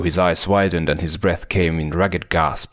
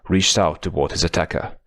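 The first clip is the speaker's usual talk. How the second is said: plain reading voice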